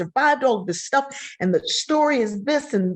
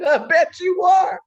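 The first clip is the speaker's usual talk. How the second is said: teasing high; 10 semitones higher, 4 dB louder than usual. Retold or imitated. Grandma teasing granddaughter.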